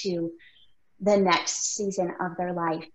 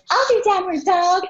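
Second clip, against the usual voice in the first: put-on much higher, bright-eyed kid voice; bubbly little girl; 12 semitones higher, 7 dB louder than usual. Youngest daughter happy to do yoga, bubbly kid voice. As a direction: chirp it like an eager six-year-old. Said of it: "she's the only one that's like".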